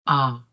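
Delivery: ah mit offenem Mund; ah kurz und offen, ohne Gleiten; soft O ah. Shown as a demonstration. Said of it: "A W is".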